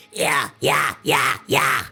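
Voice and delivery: bright rasping scream on yeah; high scream. Shown as a demonstration. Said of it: "think yeah".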